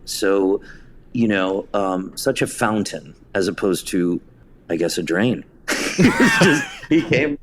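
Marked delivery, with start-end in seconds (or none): 5.9-7.4 laughing. Real, not acted. Guest laughing at his own quip, high laughter.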